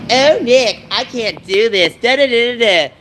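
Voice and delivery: high, slow whiny excuse; mock lazy whine. Brother mimicked whining he can't do it. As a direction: whine like a lazy guy dodging work. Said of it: "So he's all lazy, slothily".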